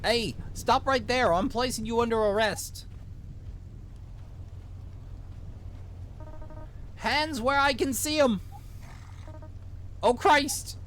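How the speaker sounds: high, urgent Minnesotan cop giving orders; vaguely Minnesotan accent. Acted. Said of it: "give him a vaguely Minnesotan accent".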